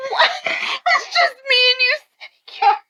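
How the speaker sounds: excited. Real, not acted. Teasing nervous friend.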